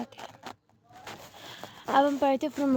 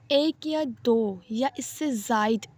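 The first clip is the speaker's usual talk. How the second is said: slow dictation; much slower than usual. Shown as a demonstration. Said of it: "dictation pace definition".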